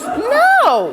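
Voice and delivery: high, emphatic retold refusal. Retelling his answer to her selfie question, emphatic. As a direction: blurt out a mock incredulous no.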